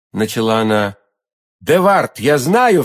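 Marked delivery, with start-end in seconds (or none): 1.6-2.8 raised-pitch blurted exclamation; impulsive cry. A narrator's reading. D'Artagnan blurting the enemy's name to milady, excited cry. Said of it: "вскричал Д'Артаньян".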